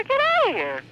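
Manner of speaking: high-pitched, exasperated disbelief; real indignant outburst; incredulous. Real, not acted. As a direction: scoff at an absurd order. Disbelief at the order.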